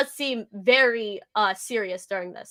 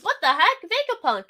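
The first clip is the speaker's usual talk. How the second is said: reading Luffy's surprise; 8 semitones higher, 3 dB louder than usual. Retold or imitated. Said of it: "Oh, he goes".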